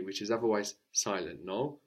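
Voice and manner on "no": normal talking voice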